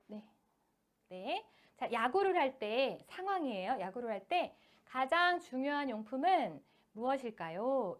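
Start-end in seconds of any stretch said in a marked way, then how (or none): 1.8-8.0 slow reading with pauses between phrases; phrased reading. Shown as a demonstration. Teacher modelling chunked reading aloud for parents, slow and phrased. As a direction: read it slowly, one chunk at a time. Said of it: "이렇게 끊어서 읽는 거죠".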